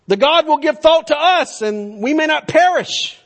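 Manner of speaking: loud, high urgent captain's plea; pagan captain rousing Jonah. Acted. Sea captain pleads with sleeping prophet, loud and urgent. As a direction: shout him awake, desperate. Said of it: "this pagan captain telling the prophet".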